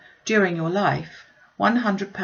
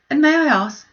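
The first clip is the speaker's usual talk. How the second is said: eager; 9 semitones higher, 7 dB louder than usual. Acted. Daughter questioning father eagerly.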